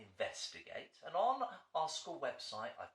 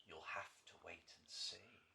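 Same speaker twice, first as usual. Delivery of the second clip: whisper; 7 semitones lower, 11 dB quieter than usual. Acted. Teasing end of the story.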